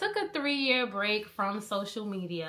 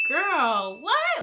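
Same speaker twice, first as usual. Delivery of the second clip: surprised greeting; 6 semitones higher, 7 dB louder than usual. Real, not acted. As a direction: squeal it in disbelief. Friend greets returning vlogger.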